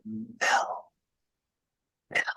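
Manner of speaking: a hushed, ghostly woman calling his name softly; imitating a faint voice calling out of the swamp; soft whispery call. A faint woman's voice from the swamp recording calling Bill's name, soft and hushed. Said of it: "soft-spoken whisper kind of voice".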